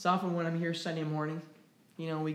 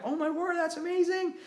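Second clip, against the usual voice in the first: much higher, gushing amazement; 12 semitones higher than usual. Retold or imitated. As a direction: gush over their trip. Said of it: "I'm like".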